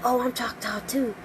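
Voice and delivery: soft hushed whisper of a confession. Retold or imitated. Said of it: "Why are you whispering?".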